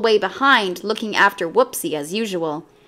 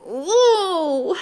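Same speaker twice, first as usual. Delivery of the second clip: high yelp of pain; pained howl; 8 semitones higher than usual. A narrator's reading. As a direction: yelp like a child who just hit her nose. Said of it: "she howled".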